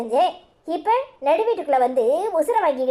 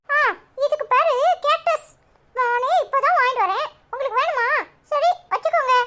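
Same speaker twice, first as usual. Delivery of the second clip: put-on much higher, chirpy small child; little boy voice; 10 semitones higher than usual. Cheeky boy greeting neighbour Lakshmi aunty, high child voice. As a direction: play a cheeky young kid.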